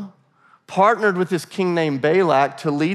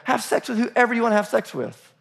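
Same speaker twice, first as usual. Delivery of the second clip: higher, breezy mock sales pitch; mock permissive teacher; 6 semitones higher than usual. Preacher mocking Nicolaitan teaching to congregation, higher and breezy. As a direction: parody them as anything goes, a bit faster. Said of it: "So the Nicolaitans said".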